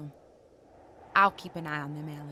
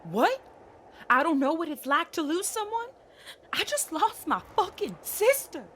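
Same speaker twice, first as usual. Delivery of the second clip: higher, louder outraged retort; raised-voice grief-fuelled outburst; indignant anger; 7 semitones higher, 5 dB louder than usual. Acted. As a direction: fire back, hurt and furious, voice climbing. Grieving sister snaps back in quarrel.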